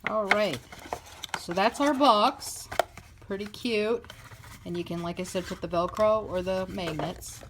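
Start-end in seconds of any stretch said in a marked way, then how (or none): none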